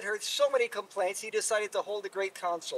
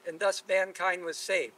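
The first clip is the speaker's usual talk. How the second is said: ordinary delivery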